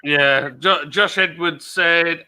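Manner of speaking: ordinary talk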